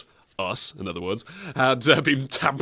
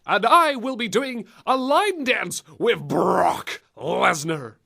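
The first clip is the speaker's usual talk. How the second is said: Paul Heyman impression; 7 semitones higher, slower than usual.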